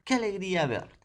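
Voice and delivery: high, loud glad greeting; joyful exclamation. Shown as a demonstration. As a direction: show the delight of the exclamation. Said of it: "Miren, ¿ah?".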